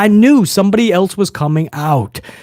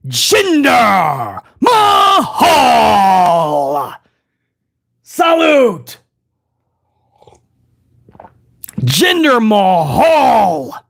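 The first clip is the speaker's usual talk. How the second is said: ring announcer; 5 semitones higher, much slower than usual. Retold or imitated. Imitating the ring announcer's introduction.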